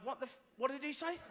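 high-pitched, baffled question to the wife; baffled complaint. Acted. Baffled TV viewer asking his wife what was said, high and exasperated.

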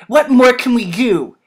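heated rhetorical question; retort. A narrator's reading. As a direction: fire it back forcefully. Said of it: "he retorted".

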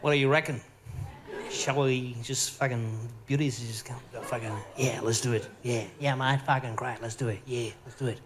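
low, conspiratorial Aussie lad; mock Aussie hunk. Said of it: "You go".

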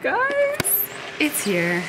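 big reveal, high then dropping; announcing big news. Real, not acted. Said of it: "I have some exciting news".

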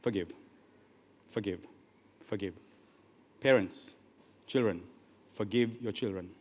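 very low, hushed plea; soft, grave, spaced-out words; quiet appeal. Real, not acted. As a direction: drop right down and urge it gently. Quiet solemn appeal to parents.

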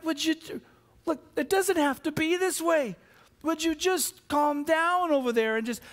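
high-pitched, straining plea for calm; exasperated, pleading with squabbling kids; exasperated parent. Acted. Voices exasperated parent to children.

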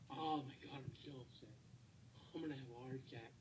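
exhausted seething under the breath; deflated anger. Real, not acted. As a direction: grumble it as if spent. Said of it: "I'm so mad".

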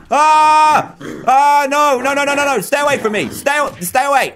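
high, loud panicked screams; panicked screaming. Real, not acted. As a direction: yell in real panic at the attacker. Fireman zombie attacking, low health.

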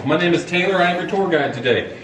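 ordinary delivery

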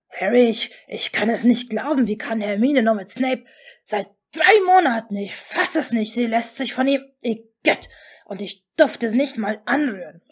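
loud, incredulous rant; too loud. A narrator's reading. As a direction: pour out disbelief and disgust in bursts. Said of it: "Geht es vielleicht noch lauter".

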